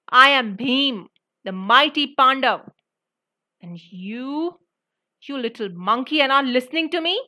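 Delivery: angry outburst, building loud; loud anger. A narrator's reading. Bhim rages at the monkey ignoring him, loud and angry. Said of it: "said loudly in anger".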